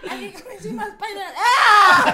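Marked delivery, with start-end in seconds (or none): 1.4-2.1 loud fake scream; hammed-up loud scream; mock scream. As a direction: yell it like a crash. Mock scream, Spider-Man fall.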